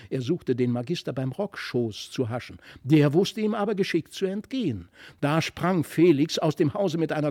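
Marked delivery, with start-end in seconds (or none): none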